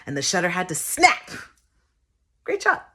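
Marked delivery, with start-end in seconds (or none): 2.4-2.9 high-pitched photo cheer; high exclamation. Acted. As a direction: pipe it up high. Mimics photographer, then repeats it.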